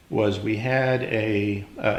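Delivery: usual speaking voice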